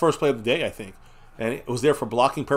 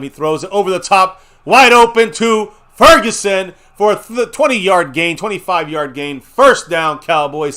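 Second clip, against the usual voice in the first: loud, rising excited call; excited play call; 5 semitones higher, 9 dB louder than usual. Real, not acted. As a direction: yell the big gain as it happens. Big completion, live call.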